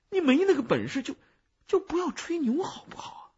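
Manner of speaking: 高音急促地气恼埋怨; 愤怒却不敢放开声; 压着嗓子发怒. A narrator's reading. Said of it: "小声，然而非常愤怒地说". (又气又急地尖起嗓子埋怨)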